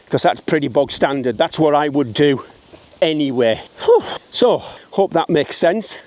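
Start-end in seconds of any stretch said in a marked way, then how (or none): 3.8-4.0 tired exhale. Real, not acted. Winded after walking uphill.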